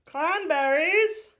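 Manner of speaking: high, drawn-out loud call. Real, not acted. Host announces a floss colour to co-host, very loud. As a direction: belt out the word slowly, far too loud. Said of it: "Why are you so loud?".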